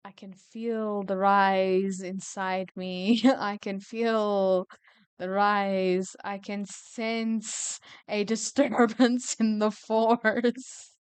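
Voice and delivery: slow, drawn-out mystic foreboding, half laughing; mock ominous. Reader sensing rage coming in the story, mock ominous. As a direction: intone it slowly like a joking seer.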